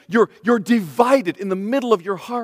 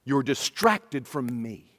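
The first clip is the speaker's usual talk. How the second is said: softer, slow, gentle calling of names; tender plea; 6 semitones lower, 5 dB quieter, much slower than usual. Acted. The Lord pleading with Martha, softer and slow. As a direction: coax them back softly. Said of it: "And he says".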